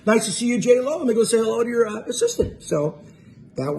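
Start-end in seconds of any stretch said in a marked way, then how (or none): 0.0-2.6 much higher, overly bright eager agreement; forced cheerfulness. Retold or imitated. Asked to photograph with the assistant, forced chirpy yes to J-Lo. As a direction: cover the disappointment with chirpy politeness.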